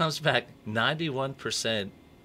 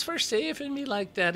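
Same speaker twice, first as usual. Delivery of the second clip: leprechaun voice. Acted. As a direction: pitch way up into a comic leprechaun. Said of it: "great leprechaun voice".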